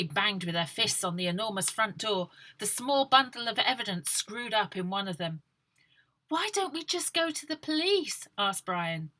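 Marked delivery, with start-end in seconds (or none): 6.3-8.3 put-on higher boy voice. Brian's question.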